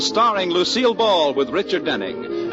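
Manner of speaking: usual speaking voice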